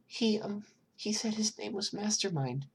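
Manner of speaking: put-on high, timid small child voice; scared child answering a detective, high and hesitant; little girl voice. Narrator voices little girl Eri.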